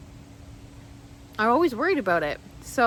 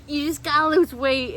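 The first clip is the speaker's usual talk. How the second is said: higher, louder mock lecture; mock preachy; 5 semitones higher, 5 dB louder than usual. A fit person lecturing her, mocking. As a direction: imitate a smug fit person.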